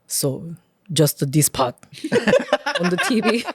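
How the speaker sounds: put-on low Korean-accented dad voice; imitated Korean dad. Dad pointing at forearm, imitated Korean-accented English. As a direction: do dad's Korean accent, deadpan and halting. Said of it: "my dad points up and down his forearm, goes".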